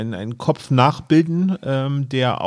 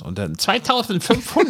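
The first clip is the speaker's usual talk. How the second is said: high put-on haggler voice; haggling voice; 9 semitones higher than usual. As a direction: bid it up in a high voice. Retelling haggling, imitating buyer. (hochgestellte Feilscher-Stimme)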